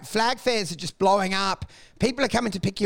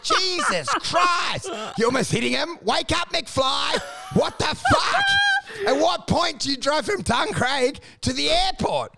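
furious yelling; 7 semitones higher than usual. Retold or imitated. Screaming at the Uber driver, furious shout. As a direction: blow up at the driver. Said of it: "I'm like".

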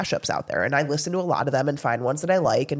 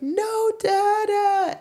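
mock toddler wail, much higher and slow; screaming toddler; 3 dB louder, much slower than usual. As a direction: stretch it into a toddler's protesting cry. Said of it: "she starts screaming".

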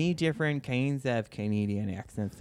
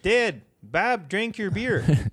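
put-on higher, slow Canadian accent, a beer-drinking dad; 7 semitones higher, much slower than usual. Joining the Canadian accent game, slow and high. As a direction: drawl it out like a Canadian at the lake. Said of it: "Canadian voice".

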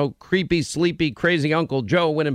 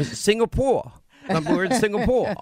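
higher, lightly put-on producer voice; Linda; 5 semitones higher than usual. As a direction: answer in a light high imitation. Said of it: "Linda's more like this".

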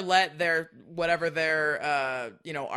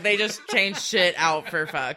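ordinary talk

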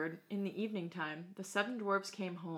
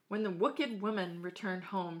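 usual speaking voice

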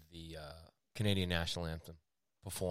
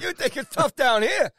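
much higher, louder and slow mock disbelief; mock incredulous; 9 dB louder than usual. Host mocking complaints about hardship, hammed-up disbelief. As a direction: ham up the disbelief.

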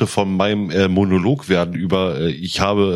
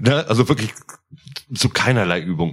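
usual speaking voice

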